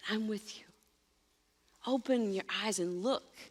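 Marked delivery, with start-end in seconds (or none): 0.1-0.6 softer and lower, gentle comfort from Jesus; calm them with a hushed, tender voice; softly reassuring frightened friends in a storm; tender reassurance. Acted. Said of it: "he's moving towards you to say".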